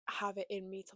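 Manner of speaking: ordinary talk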